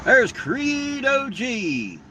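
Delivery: very slow, high, stretched-out hello; happy greeting. Real, not acted. Spotting a viewer in the chat on his birthday, high slow welcome. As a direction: sing out the name in welcome.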